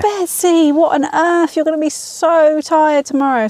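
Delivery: high-pitched exasperated parental scolding, retold; telling her off. Half-asleep mum to daughter at night, imitated telling-off. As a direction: act out a weary mum's reproach. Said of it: "half asleep telling her off".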